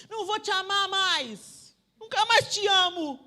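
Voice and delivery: put-on high, slow sulky child voice; childish threat. Young preacher threatens her mother, sulky child. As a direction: sulk like a hurt little girl. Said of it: "quando eu falava pra ela assim".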